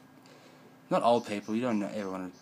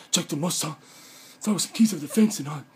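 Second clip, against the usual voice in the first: higher, louder put-on rant; mock ranting voice; 8 semitones higher, 9 dB louder than usual. Mocking people who snap at others, higher louder rant. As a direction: squeal it out like someone losing it. Acting out an angry outburst.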